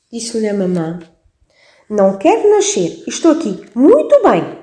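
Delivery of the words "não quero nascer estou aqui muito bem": put-on higher, louder insistent baby voice; the baby insisting to mum, higher baby voice; stubborn baby. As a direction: dig in like a stubborn little baby. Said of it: "teimava o bebê".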